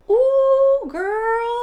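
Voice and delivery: high, loud, delighted ooh; excited squeal. Real, not acted. Reacting to blush.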